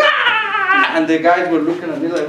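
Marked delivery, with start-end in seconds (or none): 0.0-1.0 very high, loud scream; imitated crazed scream. Kid acts crazy to scare off bullies, imitated scream. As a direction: shriek like someone suddenly going crazy. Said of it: "He started screaming".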